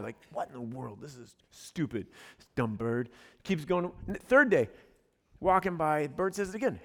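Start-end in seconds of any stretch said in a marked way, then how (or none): 0.3-3.1 low, soft mock grumble; understated mad. Lady insulted by a pet-store bird, understated grumbling anger. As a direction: retell her annoyance under the breath, low and quiet. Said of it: "now she's mad".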